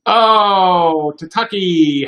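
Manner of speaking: a sudden high, loud "oh" of happy surprise; light up as you spot a friend's name; delighted oh. Real, not acted. Spots a friend in chat.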